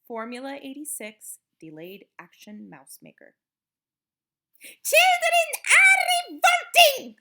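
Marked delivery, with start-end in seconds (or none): 4.6-7.2 high-pitched witch's scream. A narrator's reading. Grand High Witch to her audience, screamed. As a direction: scream it out as a furious rallying cry. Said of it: "screamed the Grand High Witch".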